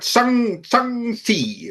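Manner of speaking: "Shang-Chi" slowly sounded out as "sung-tee"; tentative pronunciation demo. Shown as a demonstration. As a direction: try "Shang" first, then "Shang-Chi" drawn out. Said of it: "something like that".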